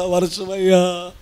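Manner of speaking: slow, drawn-out imitation of tearful begging; mock whining plea. Shown as a demonstration. Preacher mimicking the wrong way to pray about rain, mock whining. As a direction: plead weakly, stretching the ending. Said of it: "అంతేగాని ఏడుస్తా".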